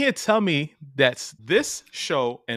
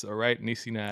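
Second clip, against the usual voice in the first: usual speaking voice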